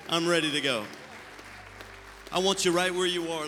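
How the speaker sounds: rousing repeat for the congregation. Shown as a demonstration. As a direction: punch "I'm ready to go" harder the second time.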